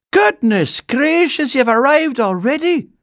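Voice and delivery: loud, very high, dragged-out dismay; horrified. A narrator's reading. Housekeeper caught out by early arrivals, horrified. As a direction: exclaim in high-pitched horror. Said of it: "she said in horror".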